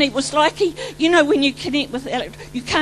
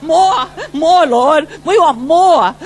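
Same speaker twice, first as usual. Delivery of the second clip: louder, laughing and sobbing plea for more; ecstatic cry; 3 semitones higher, 8 dB louder, slower than usual. Real, not acted. Preacher cries out to God.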